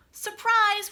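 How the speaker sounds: high, loud Surprise!; big storybook surprise; excited reveal. Acted. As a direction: burst out the reveal to the kids. Repeated reveal in read-aloud.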